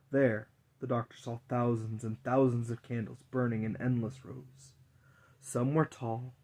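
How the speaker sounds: ordinary delivery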